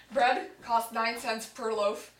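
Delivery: ordinary talk, nothing marked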